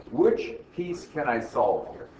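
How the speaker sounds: key question drawn out slowly; slow, deliberate question. Real, not acted. Teacher repeating the key question to the class, slow and deliberate.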